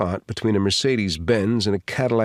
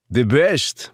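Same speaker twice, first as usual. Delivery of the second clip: put-on higher, louder Russian accent; Russian-accented reply, pitched up; Saint Petersburg Russian accent; 4 semitones higher, 5 dB louder than usual. A narrator's reading. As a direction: answer crisply in a Russian accent. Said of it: "with a perfect Saint Petersburg accent".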